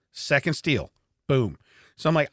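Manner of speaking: ordinary delivery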